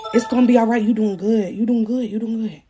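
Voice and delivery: low, repetitive soothing, imitating a partner; mock reassuring. Baby daddy soothing her during delivery, mock reassuring.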